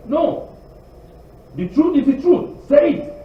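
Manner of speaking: rising passionate preaching; forceful rebuttal. Real, not acted. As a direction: fire back with conviction.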